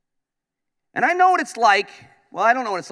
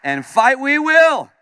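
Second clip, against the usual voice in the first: slow, loud and higher battle cry; rallying call; 8 semitones higher, 7 dB louder, slower than usual. Real, not acted. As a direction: rouse the room to fight. Rallying the crowd to fight.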